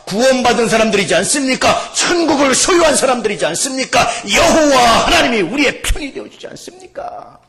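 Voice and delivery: shouted, high rhetorical questions; full-force preaching. Real, not acted. Preacher reminding believers they are saved, exultant shout. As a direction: build the congregation up with ringing questions.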